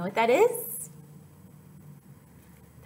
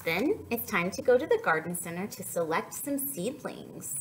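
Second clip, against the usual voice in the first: slow, gentle storybook reading; picture book read aloud; reading to children. Acted. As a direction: read it like story time for toddlers.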